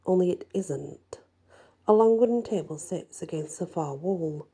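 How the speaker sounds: usual speaking voice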